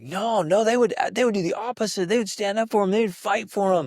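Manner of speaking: put-on high, loud eager child answering; kid voice. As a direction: play an excited kid. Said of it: "each child would be like".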